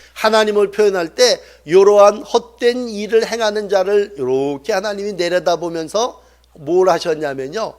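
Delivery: ordinary talk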